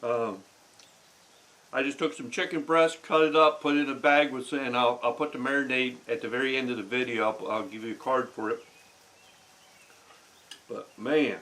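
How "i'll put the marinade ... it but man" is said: talking through a mouthful of food; mouth full. Real, not acted. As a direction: chew and talk with mouth full. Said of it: "talking with my mouth full".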